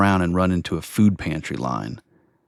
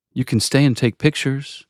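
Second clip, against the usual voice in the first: higher-pitched, lightly acted permission; a hint of a church lady, voice lifted; lightly voiced church lady; 6 semitones higher than usual. A narrator's reading. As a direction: lightly lift the pitch for her line. Church lady gives narrator permission.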